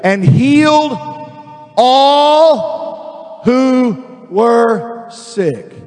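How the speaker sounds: word by word, stretching each one out; preacher slowly hammering home the verse; really slow reading. Real, not acted. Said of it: "I'm gonna read it really slow".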